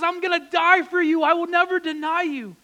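loud, high, earnest vow; Peter's loud vow. Acted. Peter vowing to Jesus, loud and high. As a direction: swear it with everything you have.

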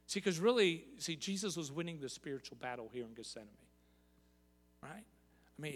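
ordinary talk

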